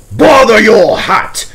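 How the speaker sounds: cross outburst. A narrator's reading. The Fat Controller brushing off the inspector, crossly and loud. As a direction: snap it out, fed up with the fuss. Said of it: "said the Fat Controller crossly".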